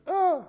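short high yell of alarm, retold. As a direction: yelp like you just saw a shark. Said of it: "And Rich went".

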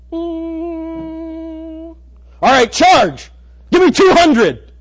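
high, loud frantic doctor shout. Retold or imitated. Preacher playing a TV doctor yelling to charge the paddles. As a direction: call for the shock like a TV medic. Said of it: "you're watching a medical show".

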